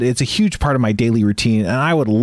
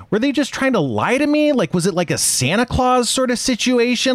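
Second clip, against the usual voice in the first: incredulous; 11 semitones higher than usual. Real, not acted. Incredulous rhetorical questions.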